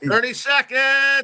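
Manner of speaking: high, loud drawn-out announcement; timekeeper call. Shown as a demonstration. Timekeeper demonstrates the time call for the host. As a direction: stretch it out across the gym. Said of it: "Yes, go see that's him".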